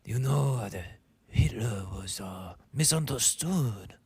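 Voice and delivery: halting mock German accent; attempted German accent. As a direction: imitate him in a badly faked German accent. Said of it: "I can't do the German accent".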